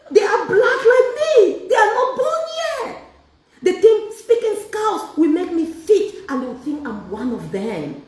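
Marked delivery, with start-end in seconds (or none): 0.1-6.2 high, loud mocking shout of a poser; a mock shout from someone trying to fit in; mocking imitation. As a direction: lampoon someone faking belonging. Mocking people who fake Scouse.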